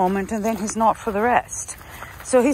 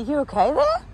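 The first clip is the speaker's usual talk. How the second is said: usual speaking voice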